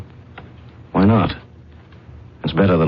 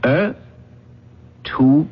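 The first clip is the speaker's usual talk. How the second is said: sharply higher, disbelieving question; incredulous; 7 semitones higher than usual. Acted. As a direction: toss it back as if absurd. Garth doubts Earth is a threat.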